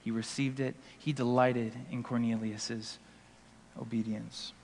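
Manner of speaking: calm Bible story narration; scripture retelling. Acted. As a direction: ease through the story quietly. Retelling scripture scene quietly.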